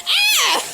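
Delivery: loud high mock scream. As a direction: shriek it out playfully. Retelling the scream.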